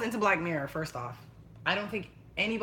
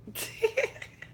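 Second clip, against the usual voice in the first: high, airy laugh; 3 dB quieter than usual. Real, not acted. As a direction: laugh it off at your own remark. Laughs after saying personally attacked.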